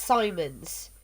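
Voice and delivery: "Simons" with a long i, SIGH-munz; "Simons" opening like "sigh"; pronounced as Simons. Shown as a demonstration. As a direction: say Simons with stress on the first syllable. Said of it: "but it's pronounced".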